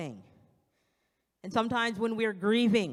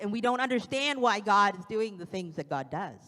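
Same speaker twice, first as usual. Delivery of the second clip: normal talking voice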